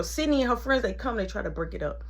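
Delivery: usual speaking voice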